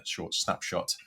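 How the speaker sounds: normal talking voice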